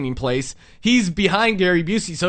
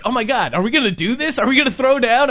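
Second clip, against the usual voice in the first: rising, excited mock panic; mock alarm; 5 semitones higher than usual. Clerk imagining being attacked, mock excited alarm. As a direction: play up the fear of a brawl, rising. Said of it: "I'm just standing there like".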